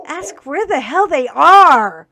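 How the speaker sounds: loud, slow, furious demand; real angry outburst. Real, not acted. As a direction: hammer it out like a scolding to the whole town. Angry rant about missing child.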